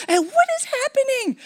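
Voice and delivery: very high, strained yelp; a shrill-pitched mix of panic and laughter; panicked cry. Retold or imitated. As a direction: cry out, shocked by the pain. Retelling the intense pain.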